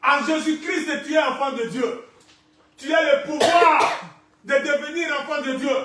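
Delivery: high, emphatic proclamation; ringing promise, pushed hard; impassioned preaching. Real, not acted. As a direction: declare it loudly from the pulpit. Preaches at full force.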